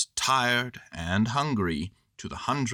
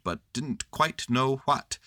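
plain reading voice

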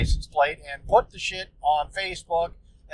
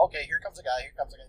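low, hushed muttering to himself; hushed self-talk; 4 semitones lower, 13 dB quieter, much faster than usual. Retold or imitated. Waiting in his car for the driver, hushed muttering. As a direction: keep it under your breath, eyes on the door.

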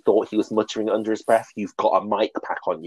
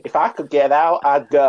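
put-on Southern accent; 4 semitones higher, 4 dB louder than usual. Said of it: "our attempt at a Southern accent".

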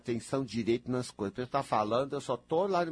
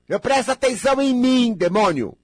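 loud, high scolding cry; exasperated shout; 11 semitones higher, 11 dB louder than usual. Real, not acted. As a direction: scold them at full voice. Bronca exaltada ao ouvinte. (bronca exaltada em voz alta)

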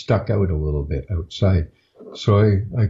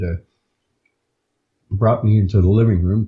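ordinary talk

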